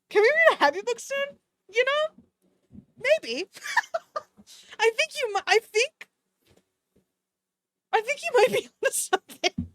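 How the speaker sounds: high squeaky pleading, laughing through it. Pretend. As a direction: beg sweetly in a tiny high voice. Begging for a heavier book.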